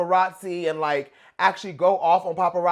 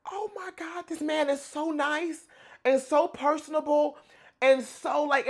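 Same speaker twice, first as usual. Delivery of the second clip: gushing; 8 semitones higher, slower than usual. Real, not acted. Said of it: "gushing about Justin".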